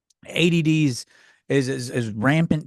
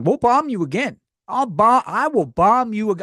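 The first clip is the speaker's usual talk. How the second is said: raised, higher pitched mock rage; hammed-up outrage, louder and higher; mock furious threat; 6 semitones higher, 4 dB louder than usual. Mock furious retaliation, mad again.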